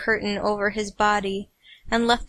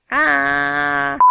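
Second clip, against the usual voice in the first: usual speaking voice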